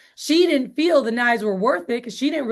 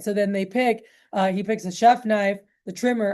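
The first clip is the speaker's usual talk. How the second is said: normal talking voice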